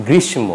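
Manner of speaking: গ্রীষ্ম with m after "sh"; quoted mistaken গ্রীষ্ম, ending "mo"; sounded m. Retold or imitated. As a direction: say গ্রীষ্ম as "grish-mo". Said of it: "গ্রীষ্ম হবে না".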